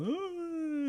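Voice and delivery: high, soft impressed ooh. Retold or imitated. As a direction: coo like an impressed listener. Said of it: "see people go".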